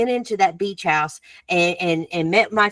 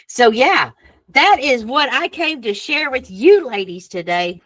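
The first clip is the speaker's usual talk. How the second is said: normal talking voice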